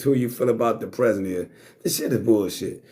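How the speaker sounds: usual speaking voice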